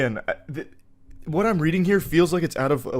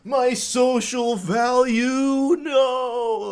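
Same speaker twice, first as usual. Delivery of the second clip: much higher, loud, slow wail of despair; hammed-up wail of a man losing status; mock anguish; 12 semitones higher, 6 dB louder, much slower than usual. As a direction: cry out as if melting away. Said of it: "he's like".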